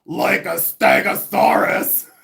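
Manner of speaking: very high, slow shouted punchline; Judy Tenuta shout. Retold or imitated. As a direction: belt it out stretched and shrieking. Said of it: "And then she'd be like".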